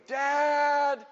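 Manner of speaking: high, loud drawn-out scream; screaming for Dad. Retold or imitated. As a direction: scream for help from the rooftop. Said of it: "I'm screaming".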